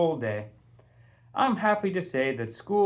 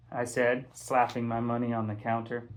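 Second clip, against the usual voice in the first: usual speaking voice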